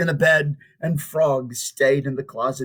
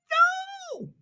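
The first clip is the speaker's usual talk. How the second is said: very high, softer, a little squeak; tiny peep; 5 dB quieter than usual. Acted. Frog answers Toad from the audience, a tiny peep. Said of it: "peeped Frog".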